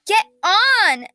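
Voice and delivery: high, loud urgent scream. Acted. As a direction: scream it out in alarm. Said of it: "she screamed".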